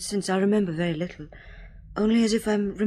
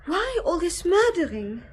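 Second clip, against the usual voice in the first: ordinary delivery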